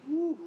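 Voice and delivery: high, loud cheer; excited whoop. Real, not acted. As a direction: let out a triumphant woo. Coach cheering a finished set.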